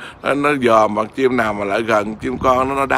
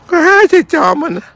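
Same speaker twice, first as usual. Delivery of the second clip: much higher squeaky voice for the bird; pet's voice; 3 dB louder than usual. Pretend. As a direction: speak for the bird in a tiny high voice. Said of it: "sư phụ ơi".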